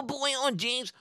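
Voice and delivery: ordinary delivery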